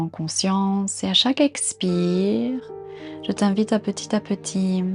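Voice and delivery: normal talking voice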